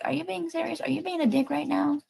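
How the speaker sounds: playfully imitating her own suspicion; mock incredulous. Vlogger replaying her doubt at a commenter, high mock disbelief.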